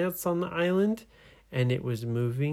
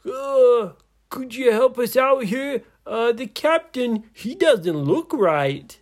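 high, loud, strained pirate voice; comic pirate calling down; trapped pirate; 11 semitones higher, 7 dB louder than usual. Acted. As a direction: ask for help while being squeezed. Trapped pirate calls down for help.

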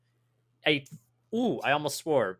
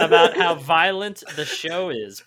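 laughing; 2 semitones higher, 8 dB louder than usual. Real, not acted. Laughing while asking.

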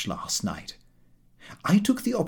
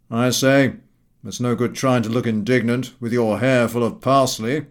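mocking Gowing voice; 3 dB louder than usual. A narrator's reading. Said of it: "who replied".

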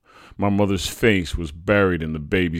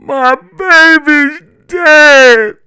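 very high, loud, drawn-out wail; 20 dB louder, much slower than usual. A narrator's reading. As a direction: wail it slowly in raw grief. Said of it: "my mother wailed".

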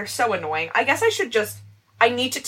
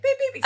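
beeping imitation; 4 dB quieter than usual. Imitating glucose monitor alarm.

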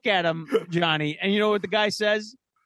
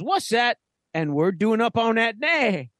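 higher, drawn-out movie-quote impression; Sam Jackson; 6 semitones higher, slower than usual. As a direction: imitate the film line's dialect and cadence. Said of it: "it was just funny the way he said it".

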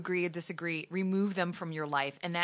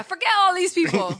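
high, loud and dismissive; playful outburst of leaving it all behind; defiant mock cry; 11 semitones higher, 7 dB louder than usual. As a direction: fling it away like you're done with everyone.

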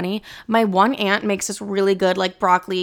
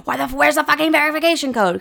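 higher, fast, annoyed outburst; exasperated mock rant; 6 semitones higher, faster than usual. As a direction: blurt it out in a fast annoyed huff.

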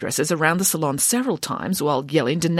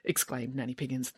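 plain reading voice

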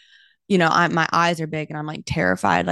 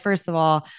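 ordinary delivery

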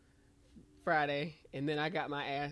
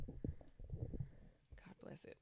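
soft whispered aside; 14 dB quieter, much slower than usual. Real, not acted. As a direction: breathe it out under your breath. Aside after the story.

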